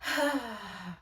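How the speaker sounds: long audible breath-out sigh; demonstrating a breathing sigh; release sigh. Shown as a demonstration. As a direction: show a deep letting-go sigh. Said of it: "taking deep breaths with a sigh".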